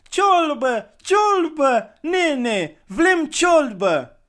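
put-on very high, loud chant of little kids; hungry children begging for soup; children shouting. A narrator's reading. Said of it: "începură să strige".

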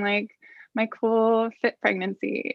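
normal talking voice

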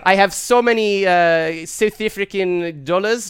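loud put-on South African accent; South African businessman. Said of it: "this is his audition".

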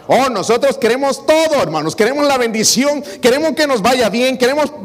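voz de púlpito, alta y enfática; predicando con fuerza. Acted. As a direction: proclama cada frase a la congregación. Preaches at full force. (predicador exhortando a los hermanos con fuerza)

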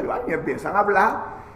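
ordinary talk, nothing marked

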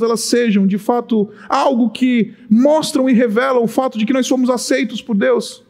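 usual speaking voice

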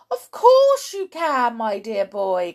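high, loud start falling to fond address; emphatic, drawn-out reassurance; hearty cry. A narrator's reading. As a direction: cry out confident encouragement. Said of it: "cried Mister Cranky".

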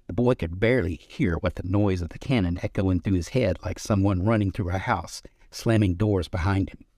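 plain reading voice

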